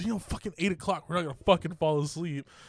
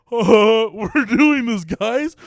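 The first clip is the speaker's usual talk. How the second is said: laughing pep talk; 4 semitones higher, 10 dB louder, much slower than usual. Retold or imitated. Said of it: "Us dumbass kids thought".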